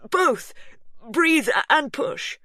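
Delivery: high and loud; husband shouting instructions, high and loud; frantic urging. A narrator's reading. Hugh urging.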